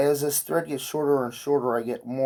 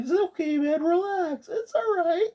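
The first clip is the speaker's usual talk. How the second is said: much higher, gentle coaxing voice; high soothing. Retold or imitated. Reaching toward the invisible creature, high gentle coaxing. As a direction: soothe it while reaching out a hand.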